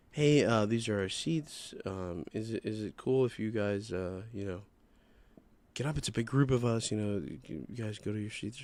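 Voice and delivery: soft, slightly low, very gentle asking; calm, polite request. Retold or imitated. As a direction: politely feel out a favor, voice kept small. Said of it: "very nicely, very nice, very calm, very, very polite".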